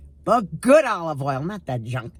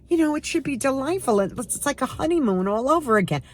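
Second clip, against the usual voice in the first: mock viewer; 7 semitones higher than usual. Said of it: "you're probably sitting there going".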